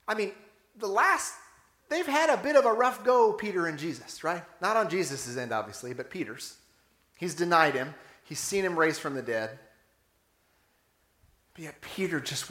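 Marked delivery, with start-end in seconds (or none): none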